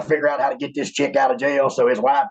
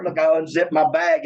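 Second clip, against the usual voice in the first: usual speaking voice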